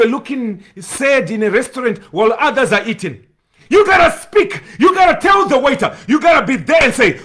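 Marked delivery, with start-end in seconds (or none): 3.7-7.2 urging the church to speak up, shouting; preaching shout. Real, not acted. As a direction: cry it out to the congregation. Preacher exhorts congregation to speak.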